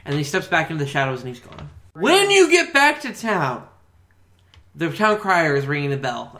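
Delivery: usual speaking voice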